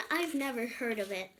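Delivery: plain reading voice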